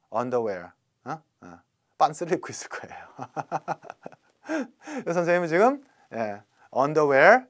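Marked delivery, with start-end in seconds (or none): 3.2-4.1 soft breathy run of chuckles. Real, not acted. Teacher amused by his underwear joke, soft laughing. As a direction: quietly giggle at your own remark.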